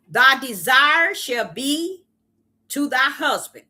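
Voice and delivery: slow, weighty reading of the verse; solemn scripture reading. Acted. Preacher reading Genesis aloud to the congregation, slow and solemn. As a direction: intone it like scripture.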